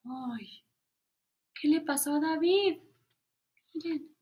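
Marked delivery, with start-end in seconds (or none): none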